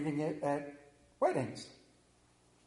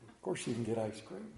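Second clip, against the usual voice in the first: tender parent; 9 semitones lower, 10 dB quieter than usual. Retold or imitated. Parent's indulgent reply.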